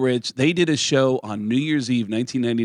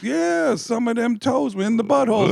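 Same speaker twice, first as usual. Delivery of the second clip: mock friend; 11 semitones higher than usual. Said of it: "And he goes".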